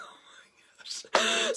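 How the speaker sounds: high laughing "Oh my gosh"; relieved laugh. Retold or imitated. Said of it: "And I was like".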